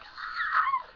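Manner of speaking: a high, laughing fan shriek; excited fan scream. Retold or imitated. Fan reliving the moment the band appeared, laughing shriek. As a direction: let out a giddy scream. Crowd reacting as idols appear.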